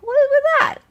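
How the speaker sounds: very high, loud squeal of disbelief; high-pitched, exasperated disbelief; incredulous. Real, not acted. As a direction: shoot way up in pitch, baffled. Disbelief at the judge's ban.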